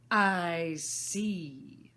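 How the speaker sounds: imperious Queen. Acted. Queen, cold and loud.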